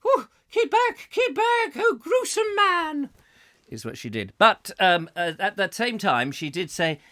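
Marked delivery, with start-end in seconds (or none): 0.1-3.1 very high falsetto recoil; mock horrified woman. Visitor warding off sneezing presenter, mock horror. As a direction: squeal in hammed-up disgust. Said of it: "she was going".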